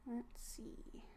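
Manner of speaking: unvoiced whisper to herself. Real, not acted. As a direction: whisper it under your breath. Checking the screen.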